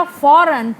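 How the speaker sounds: Foreign with a strong first syllable; stress on first syllable. Shown as a demonstration. Teacher shows where stress goes, foreign stressed on फॉर. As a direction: hit फॉर in foreign, let the rest drop. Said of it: "फॉर पे हमारा stress गया".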